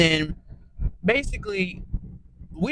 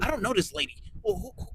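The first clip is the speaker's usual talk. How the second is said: high, panicky mock voice, much higher; scared Tanisha; 10 semitones higher, 3 dB quieter, faster than usual. Tanisha, scared she'll have to fight, denies knowing Rolly. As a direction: squeak it out like a frightened woman dodging a fight. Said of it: "Tanisha was scared".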